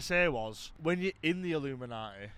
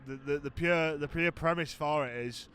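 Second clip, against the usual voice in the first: normal talking voice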